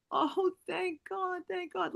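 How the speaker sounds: high, soft, drawn-out tearful relief. Retold or imitated. Sister hearing the message from her late sibling, tearfully relieved. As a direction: imitate someone crying with relief, voice lifted high. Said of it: "Pam just starts crying".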